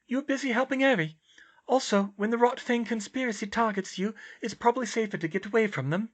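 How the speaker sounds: high-pitched, airy character voice; dreamy high girl's voice; serene oddball girl. Acted.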